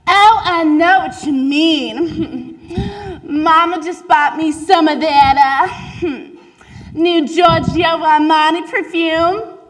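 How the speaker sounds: put-on giggly rich girl. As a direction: brag about perfume through giggles. Another girl brags, laughing.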